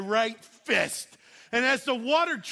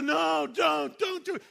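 pleading onlookers; 7 semitones higher, 3 dB louder than usual. Retold or imitated. Onlookers beg him not to dive.

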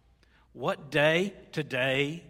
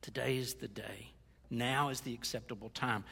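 low, soft, near-whispered solemnity; hushed appeal; 5 semitones lower, 6 dB quieter than usual. Real, not acted. Preacher urging congregation to act today, hushed and solemn. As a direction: drop right down and let it land quietly.

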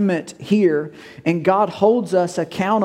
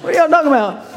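confused congregation; 6 semitones higher, much faster than usual. Retold or imitated. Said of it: "everybody's like".

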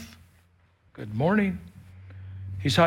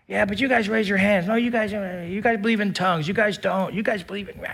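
higher, mock argumentative churchgoer voice; 4 semitones higher than usual. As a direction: play the pushy churchgoer, a bit higher.

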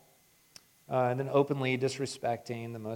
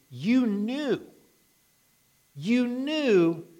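pleading sermon voice, pitch raised; impassioned preaching; 6 semitones higher, 4 dB louder than usual. Acted. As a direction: drive home "you knew" like an accusation. Preacher presses the accusation.

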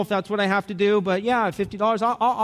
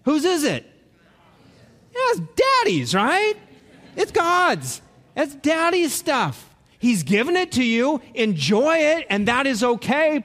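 preaching at full force; 6 semitones higher than usual. Acted. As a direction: drive it home to the congregation. Preaching peak, raised voice.

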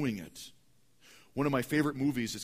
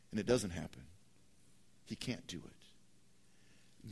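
hushed; 6 semitones lower, 12 dB quieter than usual. Real, not acted. Hushed sermon aside.

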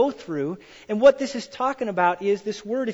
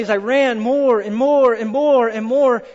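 building excitement; 3 semitones higher, 8 dB louder than usual. Real, not acted. Building emphasis.

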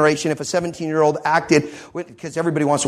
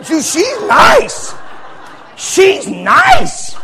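high, loud, stretched-out shouts of delight; a teen who just won the prize; thrilled; 12 semitones higher, 8 dB louder, slower than usual. Retold or imitated. David thrilled about the king's daughter.

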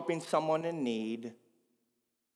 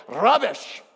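RUBBISH punched out in a little accent; little put-on accent; 7 semitones higher, 9 dB louder than usual. Shown as a demonstration. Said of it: "Say it in that little accent".

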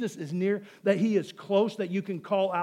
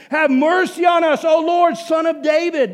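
high, very loud beggar's shout; screaming plea; 6 semitones higher, 8 dB louder than usual. Acted. Preacher as blind beggars calling to passing Jesus, screaming plea. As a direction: shout with all your might for mercy. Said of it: "They are shouting and screaming".

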